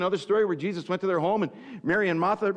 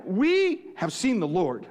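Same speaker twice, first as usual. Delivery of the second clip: slow proclamation; slower than usual. Acted. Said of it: "they told him".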